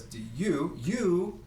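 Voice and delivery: second "you" jumps up high; stress on YOU. Shown as a demonstration. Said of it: "putting stress on the word you".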